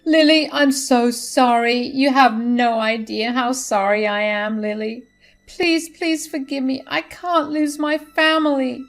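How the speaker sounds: high, loud, mock-tearful apology; mother-in-law apologizing to Lily, mildly tearful. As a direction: beg forgiveness with a hint of tears. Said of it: "She tearfully said".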